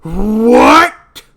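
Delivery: very loud, high, rising bellow; outraged shout. Acted. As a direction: explode in disbelief at the accusation. Acted quarrel over dead wife.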